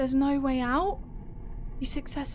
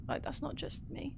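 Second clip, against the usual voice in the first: breathless frightened whisper; scared whisper; 14 dB quieter than usual. Real, not acted. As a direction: whisper it, afraid to be heard.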